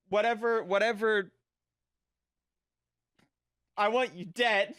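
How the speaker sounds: usual speaking voice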